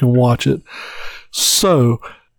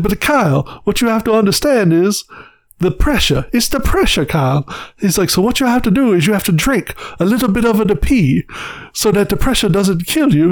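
mock Italian accent; 5 semitones higher than usual. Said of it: "stereotypical Italian guy".

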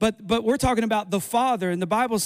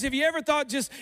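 normal talking voice

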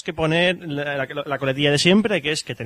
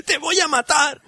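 mock death threat; 10 semitones higher, slower than usual. Mock threat over cookie joke.

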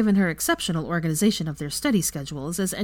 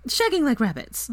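ordinary delivery

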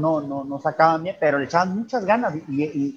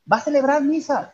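retold shout; 11 semitones higher than usual. Young man announcing the mass to the party, mock shout. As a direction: imitate a surprised youth calling out. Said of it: "les gritó a todos".

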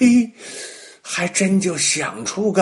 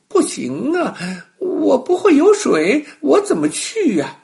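high, bright little monkey voice, a bit louder; 12 semitones higher, 5 dB louder than usual. Acted. Little monkey tells the crocodile he can't swim, high and pleading. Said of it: "小猴子摇了摇头说".

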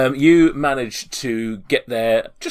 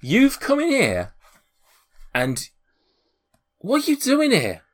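very high-pitched, affronted challenge; high, incredulous outrage; indignant homeowner; 13 semitones higher, 6 dB louder than usual. Acted. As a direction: confront intruders in a high, outraged voice. Man confronts intruders in his home.

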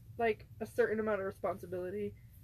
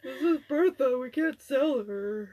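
louder, slow, mock-doting announcement; doting mock owner; 4 semitones higher, 10 dB louder, slower than usual. Pretending to name a puppy she won't sell, mock doting and loud. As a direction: present the puppy you'll never sell. Imagining keeping the puppies.

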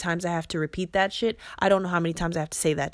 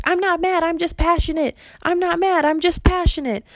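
much higher-pitched insistence; high, raised-voice self-mimicry; defensive protesting; 10 semitones higher, 4 dB louder than usual. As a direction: imitate protesting your innocence up high. Retells past protests of not being mad.

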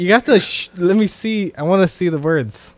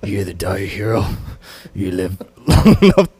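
tired low voice; 9 semitones lower, 3 dB louder than usual. Retold or imitated. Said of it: "I gotta do your tired voice".

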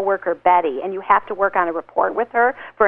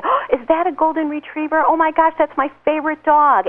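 higher, excited over the dog; bright friendly gush; 7 semitones higher than usual. Shown as a demonstration. Greeting a stranger about their dog, excited gush. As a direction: gush like a delighted dog lover.

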